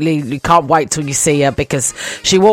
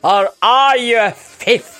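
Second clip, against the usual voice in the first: put-on slow, higher, theatrical Scottish accent; imitation Scottish, drawn out and dramatic; Alan Cummins's Scottish accent; 4 semitones higher, slower than usual. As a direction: stretch it out in a hammed-up Scottish accent. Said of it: "It's like me trying to say it. He's like".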